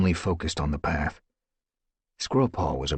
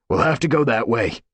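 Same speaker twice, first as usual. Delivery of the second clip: louder call to the group; 5 semitones higher, 5 dB louder than usual. Acted. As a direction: call them toward the gap. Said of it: "Firestar called".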